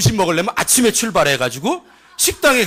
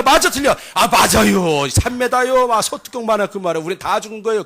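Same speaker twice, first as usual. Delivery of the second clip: Chungcheong dialect; 3 semitones higher than usual. Acted. Spy overrules doubters with ten witnesses, Chungcheong accent. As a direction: argue it out in Chungcheong speech. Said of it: "충청도 버전으로".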